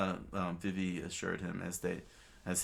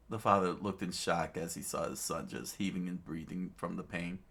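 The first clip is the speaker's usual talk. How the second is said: ordinary delivery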